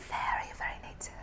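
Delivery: hushed insider tip; whispered emphasis. Acted. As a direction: drop to a confiding whisper on very native.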